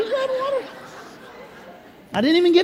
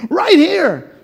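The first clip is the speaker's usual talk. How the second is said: usual speaking voice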